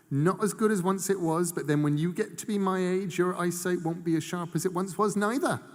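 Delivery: put-on a spry old gran poking fun at her own age; an old woman making a joke at her own expense; old Mrs Hempstock. A narrator's reading.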